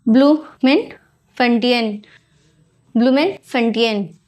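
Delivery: ब्लूमेनफंटेन spelled out slowly as blu-men-fun-tain, falling at the end; syllable by syllable, ब्लूमेनफंटेन; careful pronunciation. Shown as a demonstration. Teacher carefully pronouncing the judicial capital for the class. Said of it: "I think it is the correct pronunciation".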